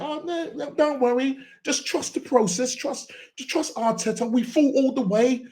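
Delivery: high, mocking fan impression. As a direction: parody the trusting fans. Mocking deluded fans.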